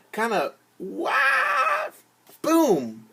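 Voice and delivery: mock fall sound. Shown as a demonstration. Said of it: "Staggered means".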